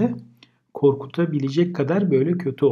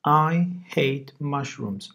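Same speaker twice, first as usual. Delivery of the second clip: slow example sentence; much slower than usual. Shown as a demonstration. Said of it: "mantardan nefret ederim gibi".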